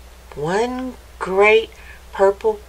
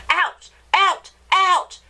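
higher pitched, fast angry throwing-out; bellowing showman; 9 semitones higher than usual. Acted. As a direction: chase the dog out with a huffy yell. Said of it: "he bellowed".

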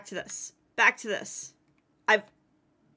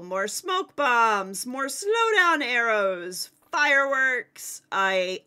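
usual speaking voice

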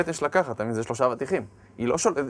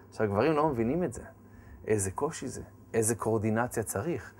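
normal talking voice

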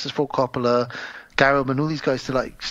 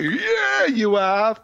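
squeaky high yeah, you have; high sarcastic quip; mock knowing audience; 8 semitones higher, much slower than usual. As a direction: pitch it way up like a knowing audience member. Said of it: "we're like".

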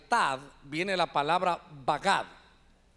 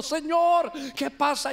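higher, louder imitated wail; mock weeping plea; 6 semitones higher, 4 dB louder, slower than usual. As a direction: act out a worshipper crying at the altar. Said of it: "imagínense el altar llorando". (súplica llorosa imitada, más aguda y fuerte)

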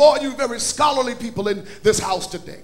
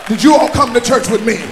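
loud, rising preacher's call over music; full-force preaching; 9 dB louder than usual. Acted. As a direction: drive it home to the congregation. Preaching builds again to shouting.

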